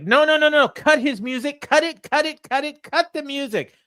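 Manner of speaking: high, loud, frantic imitation of MJF; mock heel champ. Imitating MJF cutting off Brody's music, high and loud. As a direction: squeal it like a bratty champ. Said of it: "M J F's like".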